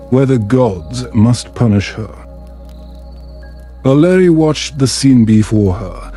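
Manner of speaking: plain reading voice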